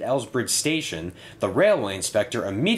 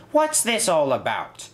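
higher-pitched inquisitive question; curious; 6 semitones higher than usual. A narrator's reading. Inspector asks the bus about the banner, curious. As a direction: ask with lively curiosity. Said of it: "he asked curiously".

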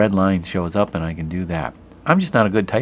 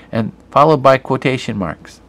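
usual speaking voice

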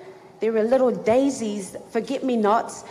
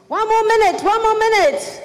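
much higher, loud call; 11 semitones higher, 7 dB louder than usual. Retold or imitated. Camp leader calls the last minute to the girls, loud. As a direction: announce it to the whole hall.

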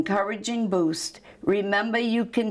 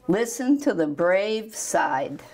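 ordinary delivery

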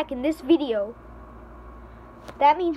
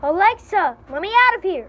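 calling out; 5 semitones higher, 6 dB louder than usual. Pretend. Calls again, louder.

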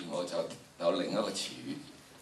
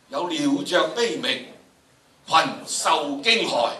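alarmed cries; 5 semitones higher, 6 dB louder, slower than usual. Shown as a demonstration. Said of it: "大概去到七度到啦".

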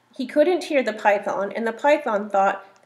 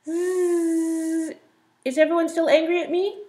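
high fast buzzy whine; whining mosquito; 7 semitones higher than usual. A narrator's reading. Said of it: "goes about whining".

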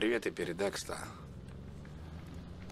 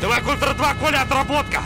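loud high game yell; shouting; 8 dB louder than usual. Real, not acted. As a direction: bark orders at a teammate. Yelling commands during game.

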